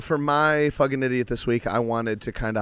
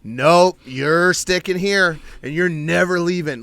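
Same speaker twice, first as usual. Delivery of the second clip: louder, higher, slow insistence; clingy refusal; 6 semitones higher, 7 dB louder, slower than usual. Retold or imitated. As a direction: refuse to let him go, loud and drawn out. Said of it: "She's like".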